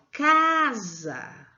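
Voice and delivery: casa with CA drawn out and strong, za light; stress on first syllable. Shown as a demonstration. Teacher showing children the strong syllable, stressed CA in casa. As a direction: lean long and hard on the CA of casa. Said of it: "Ela foi pronunciada mais fortemente".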